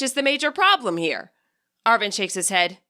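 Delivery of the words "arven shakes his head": plain reading voice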